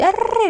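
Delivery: 에르르 as one long tongue trill; rolled r. Shown as a demonstration. Teacher showing needless full rolling, 에르르 strongly trilled. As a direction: exaggerate the roll in 에르르. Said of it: "굴릴 수 없습니다".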